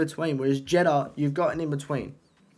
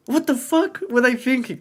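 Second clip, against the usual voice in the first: outraged exclaim; 10 semitones higher, 5 dB louder than usual. Acted. Outraged rant about livery.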